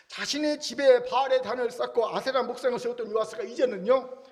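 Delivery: usual speaking voice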